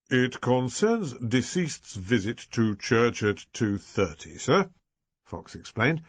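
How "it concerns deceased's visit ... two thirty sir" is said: Inspector Fox voice, slow. A narrator's reading.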